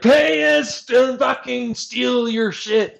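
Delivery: high, slow sneering demand; mocking greedy government. Player mocks the government's demand, slow high sneer. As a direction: drawl it out like a greedy official mocking. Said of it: "And so they're like".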